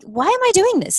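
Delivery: high, exasperated question to herself; exasperated cry. Retold or imitated. Seller voicing her own frustration, high and exasperated. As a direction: throw your hands up at yourself, pitched high. Retold frustrated self-talk.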